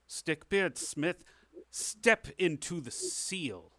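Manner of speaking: stately royal command, drawn out; king's summons. Acted. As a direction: summon the knight-to-be ceremoniously. Game master as king summons player.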